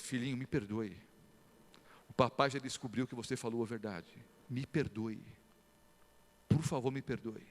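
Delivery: soft, low plea for forgiveness; a father softly asking his crying son for forgiveness; tender apology. Retold or imitated. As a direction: gently beg the little one's pardon.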